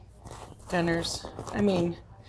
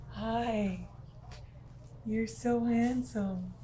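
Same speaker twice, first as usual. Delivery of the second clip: ordinary delivery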